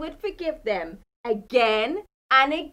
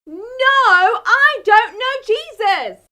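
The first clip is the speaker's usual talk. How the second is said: loud, high, slow, drawn-out no; desperate denial; 8 semitones higher, 12 dB louder, much slower than usual. A narrator's reading. Peter's third denial at the fire, loud slow desperate. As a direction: drag out the final denial desperately.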